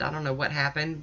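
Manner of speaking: normal talking voice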